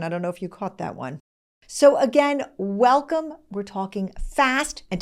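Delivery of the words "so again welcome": high, loud, eager greeting; excited welcome. Real, not acted. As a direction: throw the welcome out with a lift. Host greeting again.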